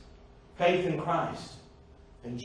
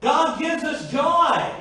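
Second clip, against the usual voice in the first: fervent preacher, voice climbing; preaching at full force; 5 semitones higher, 4 dB louder than usual. Real, not acted.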